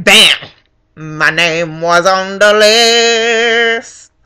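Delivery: very slow, stretched words; drawn-out reveal. Real, not acted. Voter revealing her name was found, drawn out. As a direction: hold each word for the big reveal. Triumphant climax of the search.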